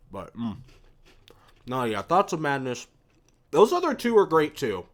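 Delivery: mouth full. Real, not acted. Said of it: "I shouldn't talk with my mouth full".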